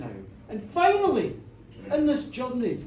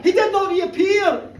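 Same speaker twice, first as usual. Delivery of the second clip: higher, louder, slowed with a laugh; loud punchline; 6 semitones higher, 7 dB louder, slower than usual. Acted. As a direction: land the punchline big.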